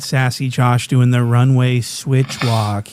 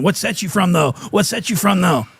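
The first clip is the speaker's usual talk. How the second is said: high mock gangster challenge; imitating a hostile street challenge; gang member; 5 semitones higher than usual. Friend imitating a gangbanger pressing for his set. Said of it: "but he was saying".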